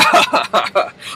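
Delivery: put-on laugh to brush it off; imitated awkward laugh. Fitz covers before the constituent, put-on laughing. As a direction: fake a little chuckle.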